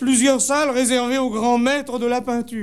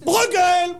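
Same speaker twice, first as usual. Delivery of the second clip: high, held-out call from the group; shouted answer; 8 semitones higher, 3 dB louder than usual. Acted. Visitor shouting the painter's name at the guide, high call. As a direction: cry it out high, stretching the vowel. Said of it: "cria quelqu'un".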